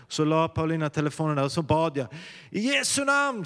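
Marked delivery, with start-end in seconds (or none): 2.5-3.5 high ringing cry of I Jesu namn; called out high. Retold or imitated. Pastor crying out to God over the baby, high call. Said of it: "så ropade jag till Gud".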